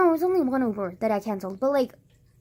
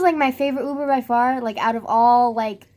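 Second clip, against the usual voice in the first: ordinary talk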